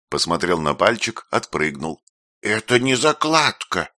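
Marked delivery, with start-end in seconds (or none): 2.4-3.9 disappointed exclamation. A narrator's reading. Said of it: "разочарованно воскликнул он".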